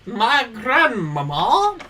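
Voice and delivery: high, slow, put-on British grandmama; mock British. Friend mocks him, repeating it in mock British. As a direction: stretch it out in a posh British voice.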